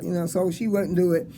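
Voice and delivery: ordinary delivery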